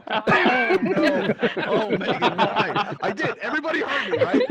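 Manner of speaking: real laughter breaking through the words; laughing. Real, not acted. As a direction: laugh helplessly while protesting. Contestant fell in unexpectedly.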